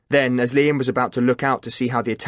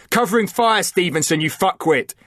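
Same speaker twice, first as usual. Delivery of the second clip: yelled order; 7 semitones higher than usual. A narrator's reading. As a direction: bark it across the gunfire. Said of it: "he yelled".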